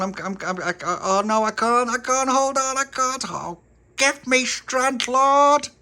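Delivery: she's slipping and begging for strength; wobbly, frightened squeaky voice; panicky stammer. Acted. Stella struggling atop the railings.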